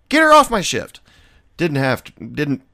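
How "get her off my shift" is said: loud, high, fed-up yell; shouted demand. Retold or imitated. Bartender yelling at his boss to remove the coworker, loud and fed up. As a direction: bark the demand at full volume.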